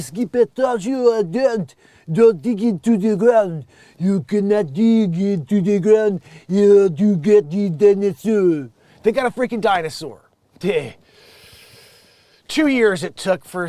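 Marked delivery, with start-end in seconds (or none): 0.0-8.7 put-on loud, slow, thick French accent; exaggerated French dog owner. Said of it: "and the French accent".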